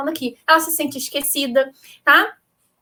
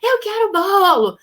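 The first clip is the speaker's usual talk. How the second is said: a mother's imitation of a toddler whining for cake, pitched higher; whining child; 4 semitones higher than usual. Mother imitating her daughter begging for cake before lunch, whiny. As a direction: whine it like a small kid who can't have cake before lunch. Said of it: "E ela fica".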